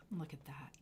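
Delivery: hushed awed whisper; soft whispered look at that. Real, not acted. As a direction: breathe it to the viewers. Whisper then loud repeat.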